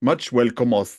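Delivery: jokey Welsh accent, lightly put on; a quick playful Welsh lilt. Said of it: "that was Welsh".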